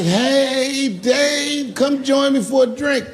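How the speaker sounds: friendly invitation. Retold or imitated. Daphne calling Dave over to the bar for a drink. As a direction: call him over warmly.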